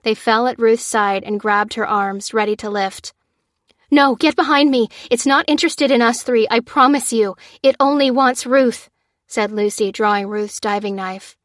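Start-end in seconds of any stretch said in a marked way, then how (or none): 3.9-8.9 higher, louder urgent orders; commanding the teens to get behind her. A narrator's reading. Lucy urgently orders teens back.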